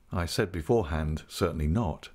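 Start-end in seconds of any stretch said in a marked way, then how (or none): none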